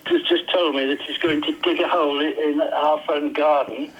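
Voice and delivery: ordinary delivery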